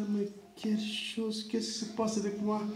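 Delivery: talking to himself in fear; anxious murmur, barely voiced; frightened murmur. Pastor frightened in the car.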